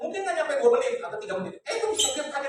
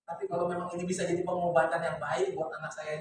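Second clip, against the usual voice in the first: ordinary delivery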